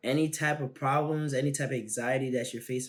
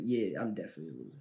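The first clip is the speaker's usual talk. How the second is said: self-defeating inner voice; 7 semitones lower, 7 dB quieter, much faster than usual. Retold or imitated.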